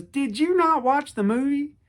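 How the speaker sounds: mock announcer disbelief; radio announcer. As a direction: challenge the caller in disbelief. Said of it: "the radio announcer goes".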